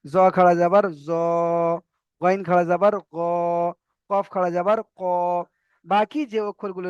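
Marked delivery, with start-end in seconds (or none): 0.0-5.0 final "জ", "গ", "ক" held on a rounded o; no aa, a long round o on "জ", "গ", "ক"; full-mouth letters. Shown as a demonstration. Teacher drills the heavy letters, rounded "জ", "গ", "ক". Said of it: "আকার বের হবে না".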